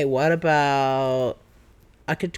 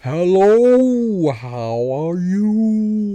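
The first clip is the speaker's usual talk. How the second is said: loud, long, stretched-out whale speech; speaking whale; 4 semitones higher, 7 dB louder, much slower than usual. Shown as a demonstration. Trying to speak whale, drawn-out whale voice. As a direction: moan each word slowly like a whale. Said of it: "Wait, wait, can I try?".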